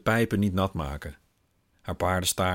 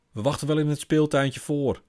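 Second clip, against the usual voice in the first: put-on high, louder kids' voice; children calling out; 8 semitones higher, 4 dB louder than usual. Children tell father they'll wait, high and bright. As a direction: call it out cheerfully.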